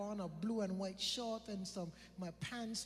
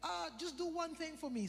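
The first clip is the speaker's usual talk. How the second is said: higher, sweetly smiling voice; gentle smile; 9 semitones higher than usual. Retold or imitated. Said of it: "So I put a nice, gentle smile on my face".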